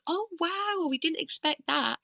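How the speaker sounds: high-pitched mock astonishment; amazed audience reaction. Audience reacting after she comes off stage, amazed.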